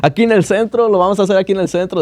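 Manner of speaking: high, fast excited chat; eager chatter. Retold or imitated. Strangers asking where the party is, high eager. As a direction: keep the fake party going. Acted dialogue about party location.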